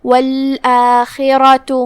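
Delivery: والآخرة recited very slowly, ending on a clear u; slow recitation of والآخرة; final u on ta. Shown as a demonstration. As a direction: stretch والآخرة and close it with u. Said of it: "تا کو پیش سے پڑھیں گے".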